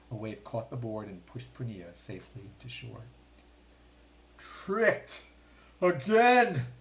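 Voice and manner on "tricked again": furious high shout of a shark king; roaring in fury; raging. A narrator's reading. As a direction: rage at being tricked. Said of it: "the king of sharks raged".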